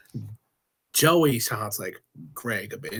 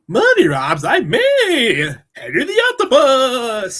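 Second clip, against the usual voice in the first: higher, louder hammed-up octopus singing; Henry the Octopus; 10 semitones higher, 10 dB louder than usual. Guest tries his octopus voice after the other. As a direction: belt it as a cartoon octopus. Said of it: "I'll give it a try".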